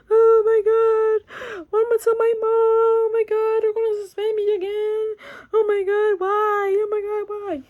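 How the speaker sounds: crying. Retold or imitated. Teen crying in the police car, dreading telling mom. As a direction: whimper it out through tears. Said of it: "I'm crying in the back of the car".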